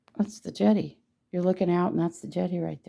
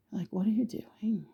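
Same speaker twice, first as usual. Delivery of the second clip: whispered mock question; 3 semitones higher, 7 dB quieter than usual. Mock viewer's puzzled question.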